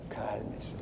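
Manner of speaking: soft unvoiced "cálmese"; a pastor whispering calm to a worried congregation. Shown as a demonstration. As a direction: breathe it out as a hush.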